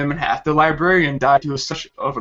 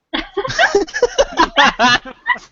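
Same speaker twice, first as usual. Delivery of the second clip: big high laugh; 4 dB louder than usual. Real, not acted. Laughing at own story.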